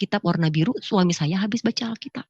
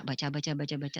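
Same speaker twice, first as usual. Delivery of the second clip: low, hushed repetition; retold quiet reading; 7 semitones lower, 12 dB quieter than usual. Woman reading on while the voice is silent, hushed. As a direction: murmur it low as if absorbed in reading.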